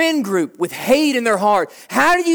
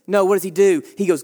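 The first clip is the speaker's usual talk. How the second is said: ordinary talk, nothing marked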